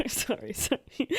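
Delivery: breathy, voiceless giggle through the words; laughing apology. Real, not acted. Reader cracks up at "time is of the essence", laughing apology.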